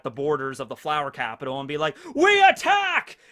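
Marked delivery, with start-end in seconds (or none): 2.1-3.2 high, slow, shouted war cry; rally the troops with a shout; battle cry. Retold or imitated. Daimyos rallying their armies.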